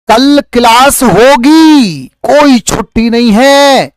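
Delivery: high, loud and slow announcement; teacher shouting to his students, no holiday tomorrow; excited shout. Acted. Loud high call.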